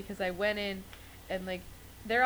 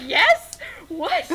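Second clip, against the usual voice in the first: high, loud thrilled yelp; thrilled shout; 9 semitones higher, 11 dB louder than usual. Retold or imitated. Fan offered a photo by her idol, loud high thrilled yelp. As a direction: blurt out in disbelief and joy. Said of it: "And I was like".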